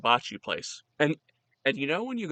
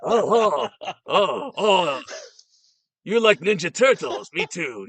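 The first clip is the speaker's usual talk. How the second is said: loud, higher hammed-up delight; mock excited chef; 5 semitones higher, 8 dB louder, slower than usual. Chef spotting a customer's interest, mock excitement. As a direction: overdo the thrilled reaction, loud and high.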